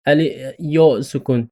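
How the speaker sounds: usual speaking voice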